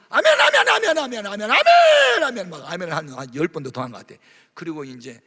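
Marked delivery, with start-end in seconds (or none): 0.1-2.5 very high, loud shouted amens; ecstatic amens. Retold or imitated. Preacher reliving his joyful amens. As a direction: shout amen over and over in joy.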